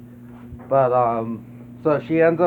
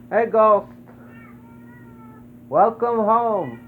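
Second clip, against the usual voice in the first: pet talk; 8 semitones higher, 3 dB louder, slower than usual. Real, not acted. Greeting the dog coming home.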